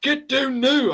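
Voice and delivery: loud, high barked order; guard's shout. Retold or imitated. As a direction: yell it like a guard catching an intruder. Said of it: "I can't do the accent".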